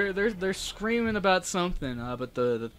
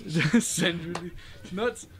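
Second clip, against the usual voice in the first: laughing; much slower than usual. Real, not acted. Laughing at a joke.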